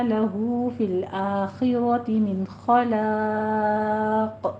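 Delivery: slow melodic tajweed chant; Quranic recitation. Acted. Teacher recites the verse for students, slow melodic chant. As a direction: chant the verse slowly, notes held.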